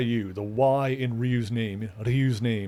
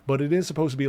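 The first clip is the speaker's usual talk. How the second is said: normal talking voice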